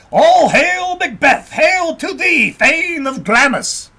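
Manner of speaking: loud, high ringing call of greeting; witch's hail. A narrator's reading. First witch hailing Macbeth on the moor, loud and high. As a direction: hail him like a witch on the heath, at full voice. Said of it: "the first woman said".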